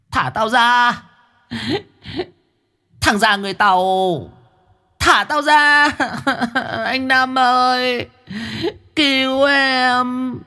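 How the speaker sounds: high, wailing spirit cries; ghost shrieking. Acted. Trapped ghost Diễm Hường screaming for rescue, high and wailing. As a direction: shriek to be set free. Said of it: "con bóng Diễm Hường đang rú lên".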